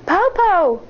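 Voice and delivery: high call for Grandma; shouting. Acted. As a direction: call out into silence. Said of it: "Shang shouted".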